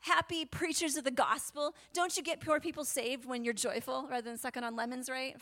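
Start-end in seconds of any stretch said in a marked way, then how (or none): none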